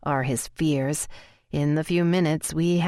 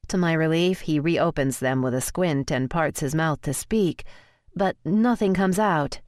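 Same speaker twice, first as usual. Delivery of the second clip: plain reading voice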